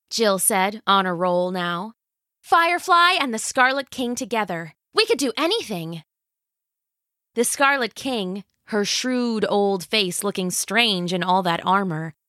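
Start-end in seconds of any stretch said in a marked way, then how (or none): 2.4-6.0 put-on higher, fast and eager girl; eager teenage girl. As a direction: gush like a thrilled teen. Eager teen heroine.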